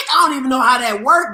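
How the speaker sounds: ordinary talk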